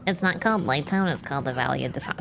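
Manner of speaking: know-it-all nerd correction. Pretend. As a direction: correct them like a pedantic nerd. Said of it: "to be the nerd voice".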